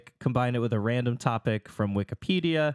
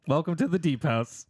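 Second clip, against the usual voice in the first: announcer voice; 5 semitones higher than usual. Acted. Announcing a house name.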